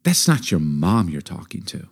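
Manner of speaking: low, quiet warning with long pauses; deliberate, hushed fatherly warning; stern father. Retold or imitated. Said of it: "He goes, "Son".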